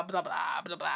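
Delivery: usual speaking voice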